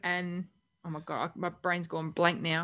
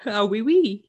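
higher, louder mock French accent; 4 semitones higher, 7 dB louder, slower than usual. Answering co-host's dare, mock French accent. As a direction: put on a playful French accent. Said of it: "you can do a French accent".

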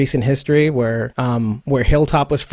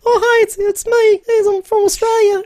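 very high, cartoonish Australian greeting; exaggerated falsetto Australian accent; mock Australian accent. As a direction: squeal it like a silly Aussie caricature. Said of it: "It's not like he sounds like".

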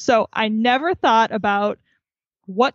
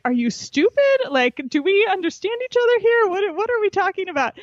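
higher mock-exasperated dad voice; pitched-up teasing dad, half disbelieving; incredulous dad; 10 semitones higher than usual. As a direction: imitate dad asking if she's serious. Dad's incredulous retort.

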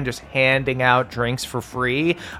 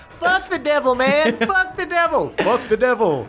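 rowdy cheer; 10 semitones higher than usual. Acted. Crowd cheering at party.

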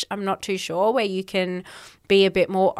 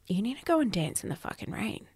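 put-on inner child voice; 9 dB quieter, faster than usual. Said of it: "the inner child in me was like".

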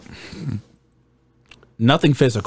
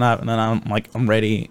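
ordinary talk, nothing marked

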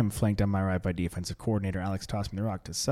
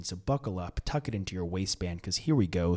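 ordinary talk, nothing marked

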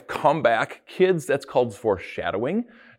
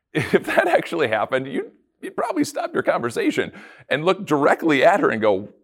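usual speaking voice

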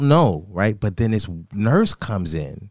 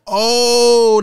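mock shock; 12 semitones higher, 7 dB louder than usual. Shocked realization retold.

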